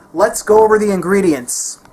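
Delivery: usual speaking voice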